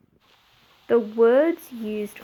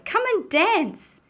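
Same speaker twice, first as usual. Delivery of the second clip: calling out; 8 semitones higher than usual. Acted. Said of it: "I cry".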